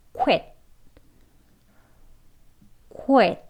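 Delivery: quit said slowly with a rounded 우 start. Shown as a demonstration. Teacher modelling a slow rounded quit. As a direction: stretch the 우 at the front of quit. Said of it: "천천히 한번 이제 우 소리 앞에 넣으면서".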